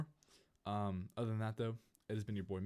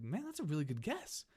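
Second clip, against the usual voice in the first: put-on high, naively amazed kid; impressed friend; 7 semitones higher than usual. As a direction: imitate a clueless friend being impressed. Oblivious friend impressed by the guess.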